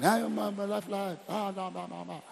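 a hint of idle mockers waving it all away; half-hearted mock of beer-parlor talk; mocking scoffer.

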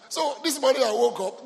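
ordinary talk

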